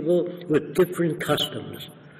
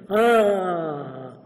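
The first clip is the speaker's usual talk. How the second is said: drawn-out, higher imitated "aah"; long "ah" of realisation; 6 semitones higher, 3 dB louder than usual. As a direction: let a long acted "aah" fall as it dawns.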